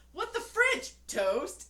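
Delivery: high, loud mock outrage; a big indignant squeal; commercial husband outrage. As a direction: bellow it like a shocked cartoon husband. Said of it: "he's all".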